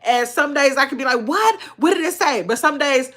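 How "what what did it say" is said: high-pitched "what?", curious and quick; eager questioning. Retold or imitated. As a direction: act out wanting to know, pitch jumping up. Acting out her eager reaction.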